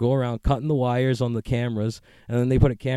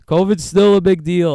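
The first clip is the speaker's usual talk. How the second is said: "covid's still a big deal" said higher and louder, mocking; mock cavalier; 7 semitones higher, 9 dB louder than usual. Shown as a demonstration. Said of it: "I sound so cavalier about it".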